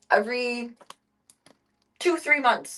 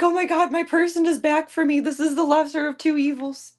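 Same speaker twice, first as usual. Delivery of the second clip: high, louder relieved cat voice; voicing the cat; 5 semitones higher, 5 dB louder than usual. Voicing her relieved cat after the carrier, high and louder. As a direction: speak for the cat, relieved and higher. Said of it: "She's like".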